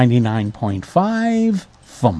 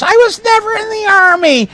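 very high-pitched hammed-up cry; theatrical squealed denial; frantic denial; 3 dB louder than usual. A narrator's reading. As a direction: wail it in melodramatic protest. Character covers ears in denial.

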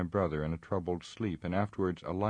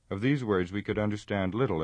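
ordinary delivery, nothing marked

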